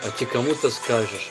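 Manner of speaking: ordinary talk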